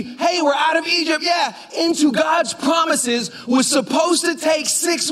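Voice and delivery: high, excited cheer; mock celebration. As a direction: call it out like you've just been freed. Voices Israelites leaving Egypt.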